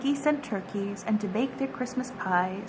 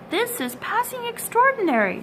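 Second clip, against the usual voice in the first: put-on higher, astonished old man; amazed old tailor; 11 semitones higher than usual. A narrator's reading. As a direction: marvel at it as the tailor. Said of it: "said the Tailor of Gloucester".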